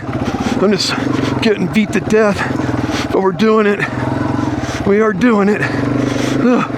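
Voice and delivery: panting between words while riding; heavy breathing, winded. Real, not acted. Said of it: "Sorry about the heavy breathing again".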